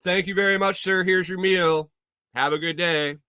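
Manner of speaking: lightly voiced polite robot; a hint of a cheery service robot. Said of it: "a robot picks it up and goes".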